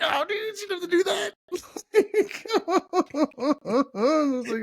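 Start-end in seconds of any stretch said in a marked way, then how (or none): 0.0-1.3 high, whiny mock sob; mock crying. Pitying the kid on camera. 1.4-3.9 high-pitched burst of laughter. Real, not acted. Laughing at the kid moment.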